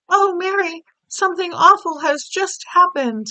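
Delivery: lightly voiced Queen, upset; a hint of a troubled Queen. The Queen telling her maid of a bad dream, a touch distressed. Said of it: "a very well-known voice".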